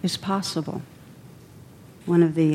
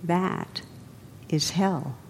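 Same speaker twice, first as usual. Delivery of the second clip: soft and gentle, much quieter; gently; 7 dB quieter, slower than usual. A narrator's reading. Old monk to the seething samurai, gentle and quiet. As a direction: let it land gently, almost a hush. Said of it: "says gently".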